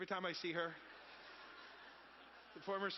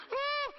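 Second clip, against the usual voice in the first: very high, loud fan shriek; overexcited high-pitched "oh"; imitated pumped squeal; 9 dB louder than usual. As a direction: squeal like someone starstruck, hammed up. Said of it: "This person's real pumped".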